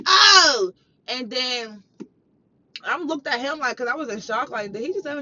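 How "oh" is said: loud mock outcry; exclaimed "Oh!". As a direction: blurt a big drawn-out "oh". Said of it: "turned around and was like".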